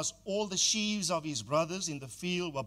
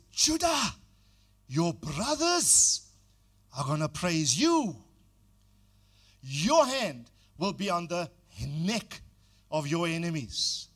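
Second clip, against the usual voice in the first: loud, slow prophetic blessing; proclaiming prophecy; 5 dB louder than usual. Acted. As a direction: pronounce it like a patriarch's blessing.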